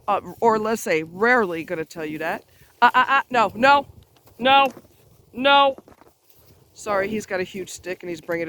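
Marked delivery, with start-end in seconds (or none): 2.8-5.8 louder, higher warning to a dog; repeated sharp no's to stop the dog; firm dog command. Real, not acted. Scolding dog carrying big stick.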